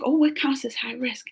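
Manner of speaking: conspiratorial whisper; hushed, scheming aside. Retold or imitated. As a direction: whisper it like a sly secret. Sneaky wondering to herself.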